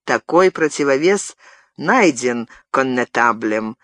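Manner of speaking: ordinary delivery, nothing marked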